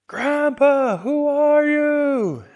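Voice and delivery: loud high call, drawn out. Acted. Young man calls across the field to the old man. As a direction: yell across the field to a stranger. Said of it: "And Matigamish says".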